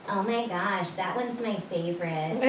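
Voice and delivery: soft, low and creepy; hushed creepy retail pitch; creepy shop clerk. Retold or imitated. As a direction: lean in close like an unsettling salesclerk. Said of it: "say something real creepy like".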